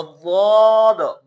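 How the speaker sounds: بود loud and slow, lips not moving; lips-still daad. Shown as a demonstration. Teacher showing daad with still lips. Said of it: "بود کے اندر ہونٹ نہیں ہلتے".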